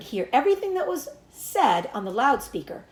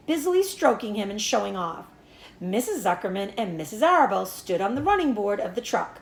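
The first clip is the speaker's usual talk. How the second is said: ordinary delivery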